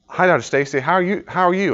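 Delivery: high, eager to please; eager greeting. Retold or imitated. Young student greeting his professor, eager and high. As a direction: greet the professor brightly to impress. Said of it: "I go".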